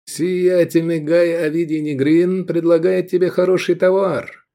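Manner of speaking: high, declamatory sales pitch; with expression. A narrator's reading. Pilot offering slaves to the owner, with expression. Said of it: "с выражением проговорил пилот".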